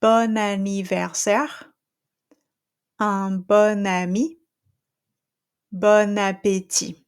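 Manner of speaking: each bon linked to the next word with a clear N; calm model reading of the links; French liaison. Shown as a demonstration. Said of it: "I'm just gonna repeat everything".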